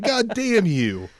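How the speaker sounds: high, drawn-out mock wail; mock anguished cursing. Retold cursing at those who ghosted.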